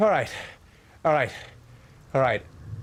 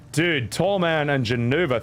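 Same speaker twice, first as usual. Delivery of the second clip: ordinary talk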